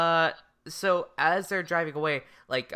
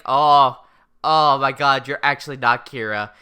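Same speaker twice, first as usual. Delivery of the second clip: louder, lower, hammed-up dawning surprise; mock realisation; 5 semitones lower, 5 dB louder than usual. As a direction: blurt it like a sudden discovery.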